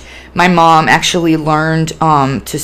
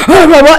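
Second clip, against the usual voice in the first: high, loud wordless angry babble; angry outburst noise; 11 semitones higher, 8 dB louder than usual. Retold or imitated. Acting out manic anger boiling over, loud garbled yell. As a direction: blurt a garbled burst of rage. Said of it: "like, anger, manic, just".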